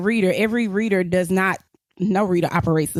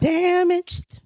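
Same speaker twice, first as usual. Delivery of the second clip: high mock echo; 10 semitones higher than usual. Echoing friend, high and drawn.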